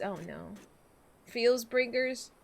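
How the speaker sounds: ordinary delivery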